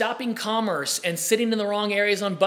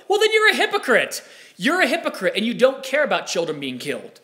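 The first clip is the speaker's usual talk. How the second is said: indignant accusation; 3 semitones higher than usual. Retold or imitated. Debater retorting to a gun-control advocate, indignant accusation.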